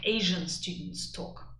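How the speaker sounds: ordinary talk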